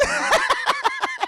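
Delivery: high, loud cackling laugh; real laughter. Real, not acted. Streamer cracks up at Caleb's death, real laughter. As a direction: burst out laughing.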